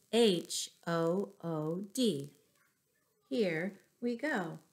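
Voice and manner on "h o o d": slow letter-by-letter "H O O D"; spelling out letters. Shown as a demonstration. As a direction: spell "H O O D" slowly for the kids. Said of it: "There it is".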